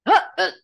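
loud, quick exertion grunts; effort grunts. Shown as a demonstration. Actor showing how she physicalizes in the booth, effort grunts. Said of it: "I physicalize a lot of stuff".

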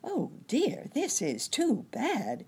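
high-pitched fretting; dismayed cry. A narrator's reading. Nurse Jane worried by Wiggily's blues, crying out in dismay. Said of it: "cried Nurse Jane".

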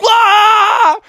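high, loud cartoon wail; frightened scream. Frogs scream at Anne's threat, loud high mock fright. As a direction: yelp in mock terror.

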